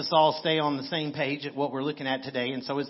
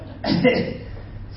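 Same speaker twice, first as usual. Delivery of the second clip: breathy laugh. Real, not acted. Laughs while teasing Molly.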